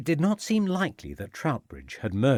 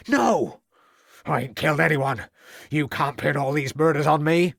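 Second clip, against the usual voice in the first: heated denial; 5 semitones higher, 4 dB louder than usual. Acted.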